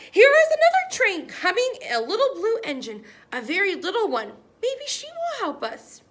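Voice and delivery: loud, high excited call. A narrator's reading. Clown calling to the toys about a blue engine. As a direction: shout the news up high. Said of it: "the little clown called out".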